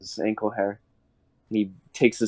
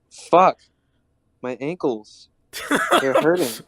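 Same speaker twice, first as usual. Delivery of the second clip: mock pained whine; 7 semitones higher than usual. Jonah whines in pain, voiced.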